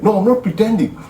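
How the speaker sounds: high-pitched defensive denial; voicing the husband. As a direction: insist he isn't faking. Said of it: "guy goes like".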